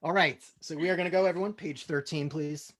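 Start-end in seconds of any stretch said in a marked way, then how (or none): none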